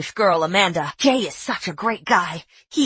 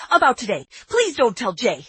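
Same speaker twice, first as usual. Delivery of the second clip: ordinary talk, nothing marked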